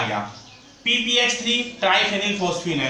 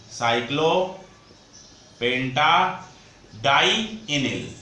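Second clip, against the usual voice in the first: "साइक्लोपेंटाडाइनिल" drawn out very slowly, part by part; slow dictation; 2 semitones lower, much slower than usual. Shown as a demonstration. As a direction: break "साइक्लोपेंटाडाइनिल" into separate parts with long pauses. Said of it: "इस ligand का नाम होता है".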